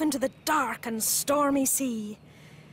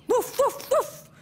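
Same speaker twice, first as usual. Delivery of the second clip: high, barked woofs; dog barking; 11 semitones higher, slower than usual. Acted. The dog calls to the lifeboat, barking high. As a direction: bark like a brave dog. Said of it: "they all heard it".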